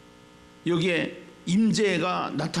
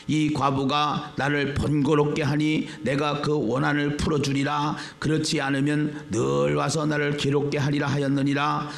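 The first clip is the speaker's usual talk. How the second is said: steady, even-toned Bible reading; scripture reading; 3 semitones lower than usual. Acted. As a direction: read the verse out formally, on a level pitch. Reads scripture aloud to congregation.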